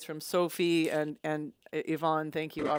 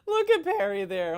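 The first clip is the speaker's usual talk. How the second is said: real amused laughter; 11 semitones higher than usual. Real, not acted. Host cracking up at a picture of the guitarist, amused laughter. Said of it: "that's making me laugh".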